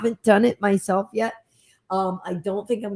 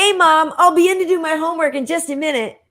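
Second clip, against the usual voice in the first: put-on high-pitched, chirpy teen calling to mom; mock teenager; 8 semitones higher, 3 dB louder than usual. Teen calling mom in an ad, mock teenager. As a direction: put on a high, louder kid-in-a-commercial voice.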